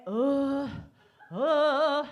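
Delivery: hammed-up loud sex grunts; loud mock grunting. Mimicking the man's loud grunts during sex. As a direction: groan it out loud and over the top. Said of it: "he's giving it".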